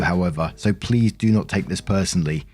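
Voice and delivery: usual speaking voice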